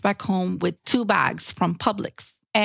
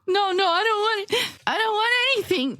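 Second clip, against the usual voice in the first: put-on very high, hurried childish wail; hammed-up tearful tantrum; like a five-year-old; 11 semitones higher than usual. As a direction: whine like an upset small child. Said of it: "like a five-year-old".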